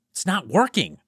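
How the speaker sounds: exasperated cry that the thing won't work; exclaiming in frustration; frustrated exclaim. Acted. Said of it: "Juan exclaimed frustratedly".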